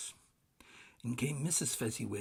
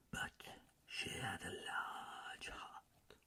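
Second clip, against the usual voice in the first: soft, breathless ghost voice, much softer; a ghost murmuring a memory; hushed spirit voice; ghost's hush; 10 dB quieter, slower than usual. A narrator's reading. Ghost speaks softly.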